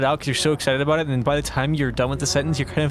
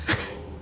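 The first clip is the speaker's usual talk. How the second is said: breathy laugh; 8 dB quieter than usual. Real, not acted. Laughing at failed inhale talk.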